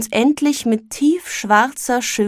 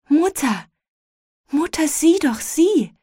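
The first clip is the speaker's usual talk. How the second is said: put-on higher, eager child calling out; a little boy tugging at his mother's attention; excited child; 6 semitones higher than usual. A narrator's reading. Boy calls mother to the shop window, excited.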